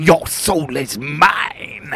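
slow, menacing villain line; Shang Tsung voice. Retold or imitated. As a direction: draw out the line like a sorcerer.

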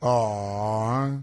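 low, drawn-out fake pity; put-on pitying aww; mock sympathy. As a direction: coo in sarcastic sympathy. Mock pity at caller's plea.

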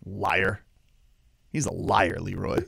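low, quiet aside after a rant; low accusation. Real, not acted. Telling the co-host the promoter lied, low quiet accusation. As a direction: drop right down in pitch and volume.

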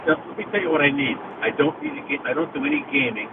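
usual speaking voice

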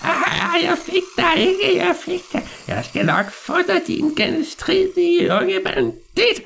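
very high, drawn-out villain voice; menacing old man's high-pitched taunt; gloating old man. Acted. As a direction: crow slowly over the escaping prey. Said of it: "høre den gamle mand grine".

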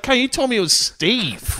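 very high incredulous protest; squeal-pitched mock outrage at being lied to; retold indignation. Helper catching drunk man's fake name, high mock indignation.

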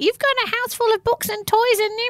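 high-pitched mock parental protest; hammed-up high exasperation; exasperated parent. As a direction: imitate an incredulous mum telling off bored kids. Mum's usual retort to bored kids.

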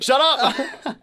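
sudden loud, high-pitched interruption; mock shout. Co-host interrupting so he can do the joke, mock shout. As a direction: cut them off with a playful yell.

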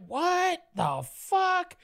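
slow, high what the fuck; drawn-out confusion. Retold or imitated. Teen hearing who is on the phone, slow and baffled. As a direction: stretch it out in baffled disbelief. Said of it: "I'm like".

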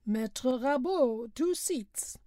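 driver calling out. A narrator's reading. Driver calling passenger names.

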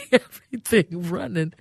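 laughing as she repeats it; words shaken by a chuckle; amused, talking through laughter. Real, not acted. Laughs repeating her own words.

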